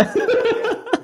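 a sudden high burst of laughter. Real, not acted. Laughing at his own reply to a YouTube troll.

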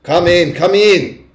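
high, loud, drawn-out witch command; snapped. A narrator's reading. Witch ordering the hesitant witches inside, snapping. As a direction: order them in impatiently. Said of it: "snapped the Grand High Witch".

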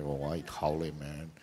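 muttered softly, slow; a quiet dig under the breath. Retold or imitated. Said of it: "I would say those things under my breath".

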